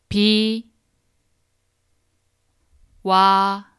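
비, 와, 요 each said apart with long pauses; syllable by syllable. Shown as a demonstration. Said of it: "break it into syllables so that you can repeat after me".

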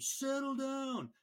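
hammed-up settle-your-team order; captain calming team. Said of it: "they'll turn to their team".

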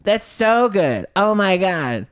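high, loud, breathless fangirl voice; mock gushing girl. Woman in the Uber gushing over the comedian, high mock gush. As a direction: gush like a starstruck fan, way up high. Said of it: "And she's like".

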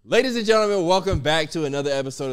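loud, high host opener; announcer intro. Acted. Host welcoming viewers in big announcer voice. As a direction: open the show like an emcee. Opening the podcast episode.

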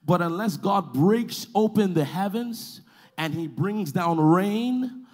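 drawn-out words with weighty pauses; slow down so every word lands; slow, deliberate preaching. Real, not acted. Preacher speaking slow so congregation gets it, slow deliberate preaching. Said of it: "I'm speaking slow".

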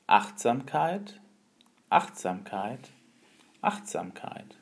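slow, fading to a whisper; wise old sage. Acted. Master repeats word, each softer.

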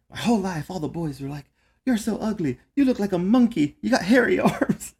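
put-on high whiny quoting of the boys' taunts; mocking bullied girl. Caseworker imitating a client retelling childhood taunts, high and whiny. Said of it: "She'd be like".

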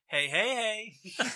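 high, loud, slow hey; drawn-out shout. Retold or imitated. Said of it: "He's walked past and gone".

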